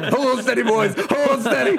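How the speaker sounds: high, strained rallying call; mock captain steadying the crew; hammed-up cry to hold the line; ship's captain. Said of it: "felt like we were on a ship".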